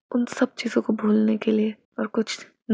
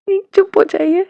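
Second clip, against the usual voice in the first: much higher and louder, flustered; embarrassed outburst; 7 semitones higher, 8 dB louder than usual. A narrator's reading. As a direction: snap at him to stop. (शर्म से झल्लाकर ज़ोर से)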